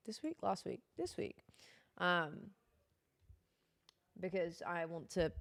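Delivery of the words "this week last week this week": much softer, aside to oneself; quiet self-check. Real, not acted. Streamer unsure when the French lesson was, quiet self-questioning. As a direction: check it under your breath.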